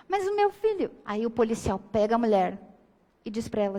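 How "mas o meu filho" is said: bem mais aguda, insistindo; mãe insistente. Retold or imitated. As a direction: protesto de mãe em tom alto. Mãe insiste com o policial. (mãe teimosa repete ao policial, voz aguda)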